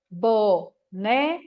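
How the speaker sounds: slow, split into BO, NE, CA with pauses; syllable by syllable. Shown as a demonstration. Teacher marking the syllables for a child, slow and paused. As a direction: say BONECA in three separate drawn-out syllables. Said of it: "A gente vai falar mais pausado do que de normal".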